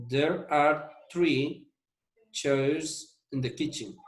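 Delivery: slow classroom demo; slow repeat. Shown as a demonstration. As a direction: spell it out slowly again. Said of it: "La primera dice".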